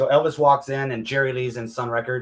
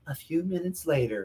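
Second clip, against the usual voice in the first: lightly imitated, softer voice; a hint of a soft impersonation; Priscilla voice; 3 semitones higher, 7 dB quieter than usual. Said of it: "her best Priscilla voice".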